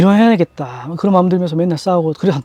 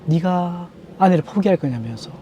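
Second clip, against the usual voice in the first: soft slow retelling; 10 dB quieter than usual. Retold or imitated. Retelling God's words to wife.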